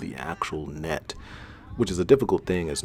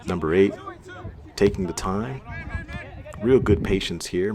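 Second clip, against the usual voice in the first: ordinary delivery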